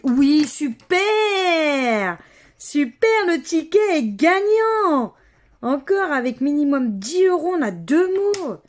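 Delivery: high, loud whoop with drawn-out word endings; real elated victory cheer; excited celebration. Real, not acted. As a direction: cry out the win, stretching the last syllables. She finds the ticket wins.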